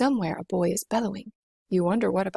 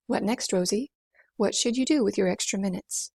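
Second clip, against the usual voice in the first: usual speaking voice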